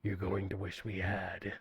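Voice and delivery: low, soft demonic growl. Acted. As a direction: speak as a menacing demon. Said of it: "This is the demon voice".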